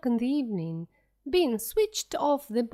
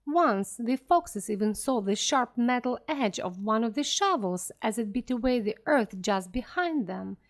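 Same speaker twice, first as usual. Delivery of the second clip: ordinary delivery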